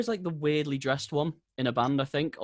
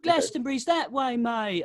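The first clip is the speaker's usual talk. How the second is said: a lightly voiced higher mocking jibe; snide Londoner; 10 semitones higher, slower than usual. Imitating a London heckler mocking his look. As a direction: sneer it like a heckler at a gig. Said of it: "they just go".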